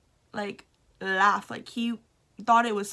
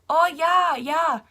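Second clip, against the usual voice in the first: higher, louder eager yeah yeah; eager agreement; 5 semitones higher, 8 dB louder, slower than usual. Retold or imitated. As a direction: agree brightly without really listening. Blindly agreeing to sister question.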